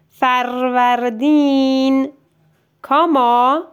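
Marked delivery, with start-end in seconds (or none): 0.1-2.1 فروردین spoken slowly, each syllable separate. Shown as a demonstration. Teacher sounding out فروردین for the child to write, slowly. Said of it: "تلفظ کن قشنگ".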